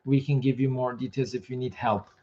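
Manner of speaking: ordinary delivery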